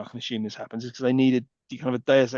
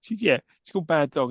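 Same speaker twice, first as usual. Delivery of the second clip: ordinary delivery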